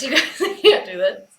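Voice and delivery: can't stop laughing, gives up; I can't do this through laughter. Real, not acted.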